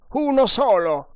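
high, loud, emphatic report; eager scout. Acted. Indian answering the sergeant's question, emphatic. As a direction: ring it out like a soldier reporting.

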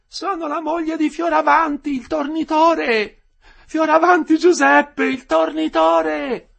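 very high, loud, stretched call; woman shouting out. A narrator's reading. Wife calling for her husband inside, shouting. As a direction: shout name and trade so they carry. Women calling prisoners' names in turn.

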